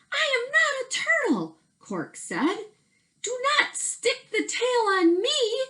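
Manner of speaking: high turtle voice. Acted.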